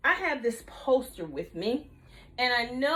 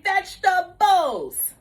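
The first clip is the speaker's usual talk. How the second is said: slow loud call; 5 semitones higher, 6 dB louder, slower than usual. Shown as a demonstration. Teacher leading kids to repeat, slow and loud. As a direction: stretch the word out slowly and loudly. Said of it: "repeat after me and say".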